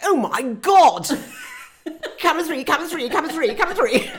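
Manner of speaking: loud, laughing, frantic calls of camera three; panicked director. Retold or imitated. Director shouting to the gallery, frantic and laughing. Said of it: "I could hear the director go".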